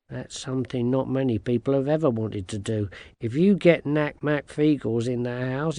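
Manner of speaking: ordinary delivery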